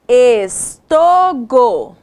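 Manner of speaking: drawn-out wrong word, testing it aloud; slow syllable-by-syllable try. Shown as a demonstration. Teacher to second graders, slowly sounding out a wrong word. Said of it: "Não, não fez sentido a nossa palavra".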